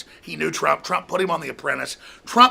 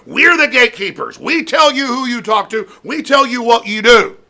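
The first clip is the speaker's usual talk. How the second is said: mock gatekeeper; 5 semitones higher, 6 dB louder than usual. Mocking media gatekeepers.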